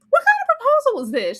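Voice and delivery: high, fast disbelief; incredulous shout. Retold or imitated. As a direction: demand an answer. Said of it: "I was like".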